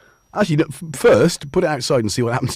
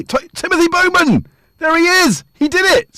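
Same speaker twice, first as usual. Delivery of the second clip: high, loud cheer of recognition; big high-pitched hail; excited calling out; 6 dB louder than usual. Real, not acted. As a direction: shout it out, thrilled to spot them. Hails a viewer arriving in chat.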